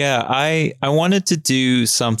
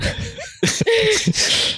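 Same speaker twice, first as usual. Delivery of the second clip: high laugh. Real, not acted. High laugh at the robot take.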